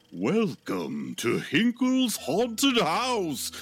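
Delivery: slow, grand showman welcome; TV host voice. Acted. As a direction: announce it like a horror show host. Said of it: "And he says".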